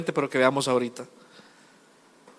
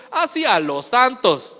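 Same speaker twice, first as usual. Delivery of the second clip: slow, higher-pitched ironic quote; mock sarcasm; 7 semitones higher, slower than usual. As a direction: draw it out as if sarcastic. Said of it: "¿O se estaba siendo irónico o sarcástico?".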